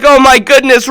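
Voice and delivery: high, loud "Oh my goodness" bursting out; thrilled exclamation. Retold or imitated. [name] reading the Netflix email, loud thrilled exclamation. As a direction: exclaim it with big joy. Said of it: "I was like".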